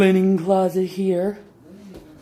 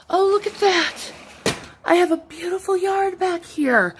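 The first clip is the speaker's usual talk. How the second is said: higher, gushing with delight; 10 semitones higher than usual. Real, not acted. Showing off her backyard to viewers, high delighted gushing. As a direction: gush over the yard like it's a treasure.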